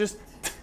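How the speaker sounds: "t" as a tiny voiceless puff, no uh after; short crisp t. Shown as a demonstration. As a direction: clip the "t" with no vowel. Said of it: "Very short, no uh sound after".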